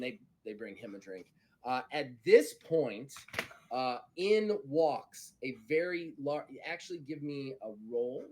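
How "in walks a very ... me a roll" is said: ordinary talk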